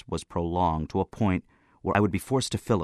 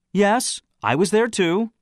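slow, high, loud show of interest; 14 semitones higher, 6 dB louder, slower than usual. A narrator's reading. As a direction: answer with drawn-out, raised interest. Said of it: "I said with interest".

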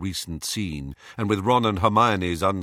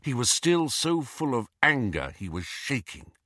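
ordinary delivery

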